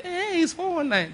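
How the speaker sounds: high, soft eh of admiration; teasing envy; admiring gossip. Retold or imitated. Young boys gossiping about the fraudster, high admiring eh.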